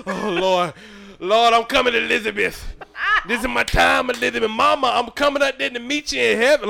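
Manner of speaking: high, loud, melodramatic cry to heaven; mock dying plea. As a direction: wail like you're about to meet Mama in heaven. Acting out a dying plea to God.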